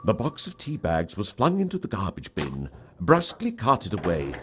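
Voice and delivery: usual speaking voice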